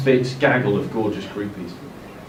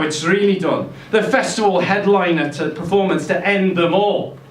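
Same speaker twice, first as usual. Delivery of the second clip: Scouse rant; 9 semitones higher than usual. Acted. Lennon ranting in Scouse voice.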